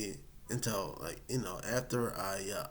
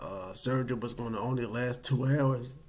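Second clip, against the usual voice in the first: ordinary talk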